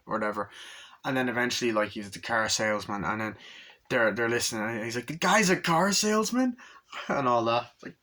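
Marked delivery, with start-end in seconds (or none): 5.1-6.6 high, rising disbelieving question; mock incredulity. Agent listening in learns the truth, mock incredulity. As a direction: act out scandalised disbelief, pitched up.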